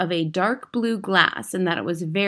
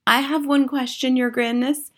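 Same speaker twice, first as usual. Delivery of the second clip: timid questioner; 6 semitones higher than usual. A narrator's reading. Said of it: "said a voice in the audience".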